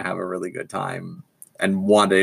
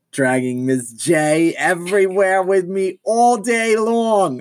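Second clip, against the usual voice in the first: Harley voice; 10 semitones higher, 3 dB louder, slower than usual. Acted. Describing Harley's loud manner.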